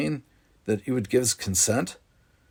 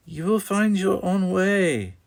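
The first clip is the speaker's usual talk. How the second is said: tender reassurance; 10 semitones higher than usual. Acted. Mother comforts son.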